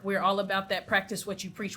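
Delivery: ordinary talk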